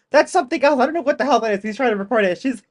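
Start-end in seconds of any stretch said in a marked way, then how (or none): 0.1-1.6 higher, louder mock indignation; half-hearted imitation of an irritated man; mock pissed man. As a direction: lightly play him as fed up. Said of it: "He's really pissed".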